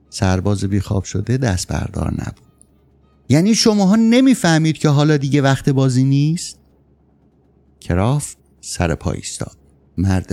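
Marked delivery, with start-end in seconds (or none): 3.3-6.6 higher-pitched irritated protest; indignant, pitched-up question; exasperated complaint. A narrator's reading. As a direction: protest like someone kept from sleep. Sleepless soldier keeps angrily complaining.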